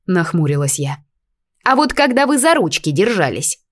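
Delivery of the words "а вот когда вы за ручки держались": teasing pixie. A narrator's reading. Fairy teases.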